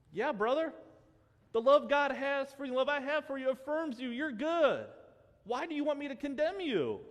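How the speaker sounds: impassioned appeal to a doubting brother; fervent preaching. Real, not acted. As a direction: preach straight to him, heart open. Passionate appeal to the commenter.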